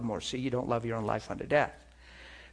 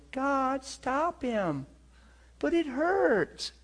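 much higher pleading whine, drawn out slowly on it hurts; mock whiny plea; 12 semitones higher, much slower than usual. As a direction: mimic a self-pitying believer begging God. Mocks a self-pitying prayer.

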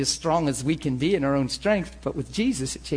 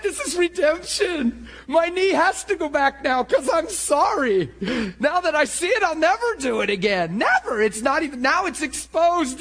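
high, stretched-out cries of revelation; retold excitement; 12 semitones higher, 4 dB louder than usual. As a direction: reenact an ecstatic breakthrough, voice soaring. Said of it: "I got so excited".

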